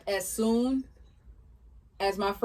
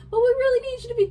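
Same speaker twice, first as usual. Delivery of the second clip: pleading friends; 8 dB louder, faster than usual. Retold or imitated.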